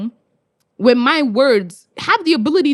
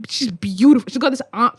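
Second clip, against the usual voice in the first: ordinary talk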